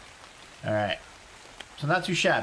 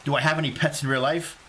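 ordinary talk, nothing marked

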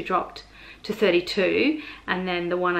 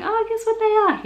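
excited; 4 dB louder than usual. Retold or imitated. Excitedly telling husband her levels.

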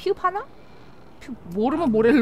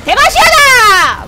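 drawn-out, much higher shouted battle cry; mock battle cry; 12 semitones higher, 12 dB louder, much slower than usual. Gamer hams up a champion's battle cry. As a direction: bellow the Demacia cry like a hero charging in.

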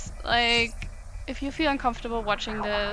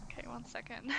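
whispered aside, much softer; 15 dB quieter, faster than usual. Real, not acted. Stepping away to wipe her running nose, a quick whisper. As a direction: breathe it off-mic, voiceless.

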